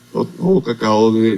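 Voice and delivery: ordinary talk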